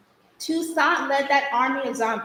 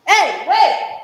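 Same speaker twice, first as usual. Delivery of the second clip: much higher, loud cry to stop someone; calling out; 7 semitones higher, 9 dB louder than usual. Acted. Girl calling after someone going off, loud. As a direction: call after someone leaving.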